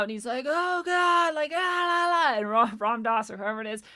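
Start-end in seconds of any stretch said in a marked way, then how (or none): 0.5-2.4 high, loud mock freak-out babble; a monk babbling in fake terror, much higher and louder. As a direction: ham up the tripping monk losing it. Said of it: "fucking freaking out".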